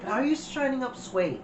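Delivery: incredulous question. Retold or imitated. Quotes own disbelief at shining suede.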